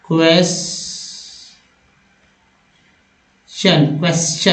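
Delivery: क्वेश्चन drawn out very slowly, syllable by syllable; slow spelled-out word. Shown as a demonstration. Teacher drawing out क्वेश्चन for students. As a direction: stretch क्वेश्चन out slowly so students hear each sound. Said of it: "यहाँ पर क्वेश्चन".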